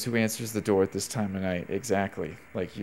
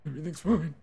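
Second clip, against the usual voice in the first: panicked reassurance; 8 semitones higher, faster than usual. Real, not acted.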